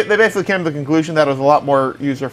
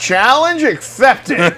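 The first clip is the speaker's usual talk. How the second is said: dramatic; 6 semitones higher, much slower than usual. Pretend. Dramatic reply to the warning.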